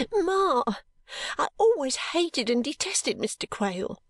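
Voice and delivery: high, tearful outburst; sobbing. A narrator's reading. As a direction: protest to mother through sobs. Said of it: "sobbed Caddy".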